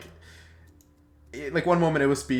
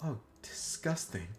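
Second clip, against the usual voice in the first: disgusted aside; 3 semitones higher, 11 dB quieter than usual. Acted. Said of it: "He's like".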